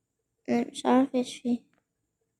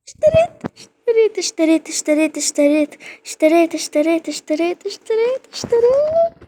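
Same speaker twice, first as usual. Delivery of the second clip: high, loud squealing repeats of اشتريت, near tears; real excitement breaking into a squeal; ecstatic squeal; 5 semitones higher, 5 dB louder than usual. Real, not acted. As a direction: squeal with disbelieving joy, climbing higher. Just bought the item she wanted.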